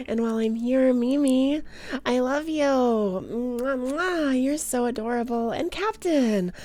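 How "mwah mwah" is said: blowing kisses. Pretend.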